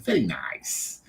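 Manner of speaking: put-on light Italian-accent very nice. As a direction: repeat his accented very nice. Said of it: "that was his accent".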